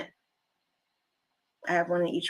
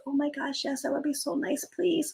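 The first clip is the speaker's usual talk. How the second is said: put-on soft-spoken old lady; 6 semitones higher, 7 dB quieter than usual. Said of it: "She's very soft-spoken".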